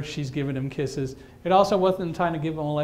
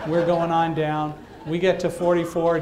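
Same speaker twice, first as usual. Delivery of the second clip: ordinary talk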